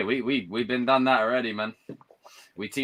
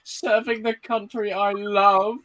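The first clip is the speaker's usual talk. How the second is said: mock patriotic speech; 7 semitones higher, slower than usual. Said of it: "She did a whole bit".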